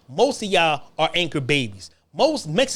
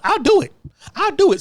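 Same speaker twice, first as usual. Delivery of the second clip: eager volunteering; 10 semitones higher, 4 dB louder than usual. Real, not acted.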